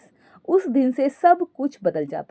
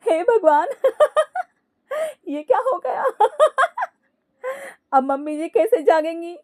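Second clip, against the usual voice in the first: high cackles between higher-pitched laments; helpless acted laughing; 11 semitones higher than usual. Daughter-in-law alone at night, lamenting amid helpless laughter. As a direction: lament through laughter you cannot stop. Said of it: "हंसने वाली दवा खा लेती है".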